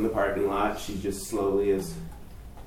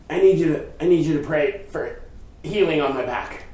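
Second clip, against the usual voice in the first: pleading lady; 5 semitones higher, 3 dB louder than usual. Retold or imitated. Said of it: "and she just says".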